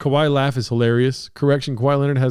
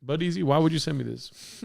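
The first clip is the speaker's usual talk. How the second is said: ordinary talk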